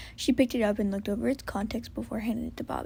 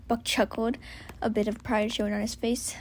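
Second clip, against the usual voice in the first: ordinary delivery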